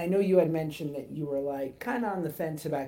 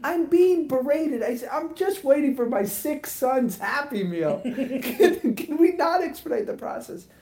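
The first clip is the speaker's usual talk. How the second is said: laughing disbelief; 8 semitones higher, 6 dB louder than usual. Retold or imitated. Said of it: "And I go".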